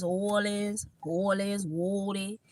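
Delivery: slow, soft New Orleans twang. Shown as a demonstration. Showing how New Orleans people talk, New Orleans twang. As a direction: lightly demonstrate a New Orleans drawl. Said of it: "that's a little twang to me".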